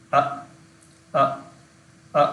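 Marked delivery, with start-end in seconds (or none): none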